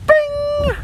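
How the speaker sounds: very high ringing "bing"; imitated bell. Host revealing the survey answer, mimicked bell. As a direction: mimic a game-show board chime.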